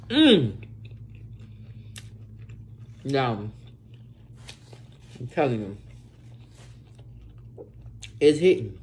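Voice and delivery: talking between bites of burger; speaking while chewing food; mouth full, chewing. Real, not acted. Seen 2: Bites at -4, chews and wipes mouth while talking.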